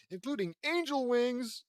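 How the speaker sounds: high, loud, excited flourish; triumphant reveal. Acted. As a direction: announce it with delighted fanfare. Character proudly reveals a marvel.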